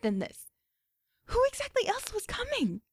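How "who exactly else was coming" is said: excited. A narrator's reading. Excited wondering.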